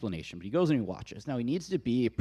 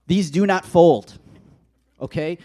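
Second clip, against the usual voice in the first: loud, slow, higher-pitched insistence; exasperated declaration; 5 semitones higher, 11 dB louder than usual. Real, not acted. Husband shows the congregation his fitted sheet, loudly exasperated. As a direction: declare it emphatically, each word hit hard.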